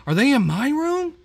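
high, loud alarmed cry; panicked yelp at a noise upstairs; scared yelp. Real, not acted. Startled gamer yelps at a noise upstairs, scared. Spooked by someone in the house.

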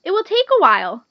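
high, loud cautioning; warning caterpillar. A narrator's reading. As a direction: warn it as the storybook caterpillar. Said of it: "the caterpillar warned".

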